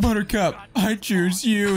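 throwing a pug in a Pokeball, high and slow; Pokemon trainer call. Pretend. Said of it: "You're like".

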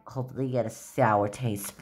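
low, soft, spiteful taunt; spiteful muttering. Pretend. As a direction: mutter a petty curse. Spiteful character taunts friends.

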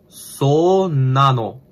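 "so nano" stretched very slowly, word by word; drawn-out demonstration. Shown as a demonstration. Learner sounding out the phrase for "oh really", "so nano" drawn out. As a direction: draw out "so nano" slowly with a falling end. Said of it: "oh really".

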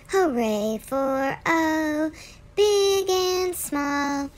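put-on slow, loud, sing-song poem for children; poem recital. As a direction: recite the rhyme with big cheer. Reads the letter poem.

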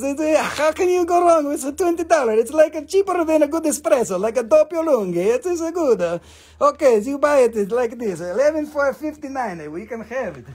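high, hammed-up Italian vendor; mixed-up Italian accent. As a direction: put on a sing-song Italian accent with a bit of Russian slipping in. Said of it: "my Russian and my Italian mixed up".

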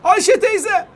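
high, loud shouted hellos; calling out greetings. Pretend. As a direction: holler playfully to people far below. Calls out greetings to people below.

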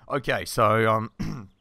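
usual speaking voice

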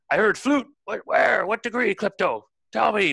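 high, loud, urgent questioning; captain hollering up. Acted. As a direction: shout up to the lookout, demanding a bearing.

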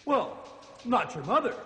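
usual speaking voice

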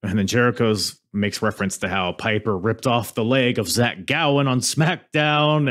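ordinary delivery

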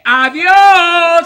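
loud, drawn-out goodbye; shouted farewell. Acted. Performer leaving the children after the quiz, shouted farewell. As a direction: call out a big stage goodbye.

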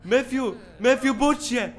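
high, loud urgent calls; calling out. Real, not acted. Player yelling at Matthew to wake up, loud call. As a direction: shout to wake someone up.

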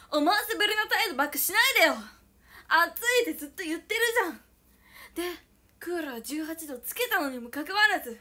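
loud, high, furious complaining at the air conditioner; hysterical rant. Acted. Scolding the air conditioner for teasing her, in a loud hysterical rant. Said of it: "ちょっとヒステリックを起こしちゃいました。".

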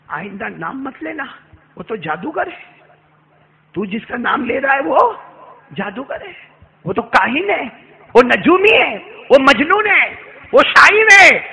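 mother forbidding her son the name; building angry scold. Acted. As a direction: hurl each insult harder.

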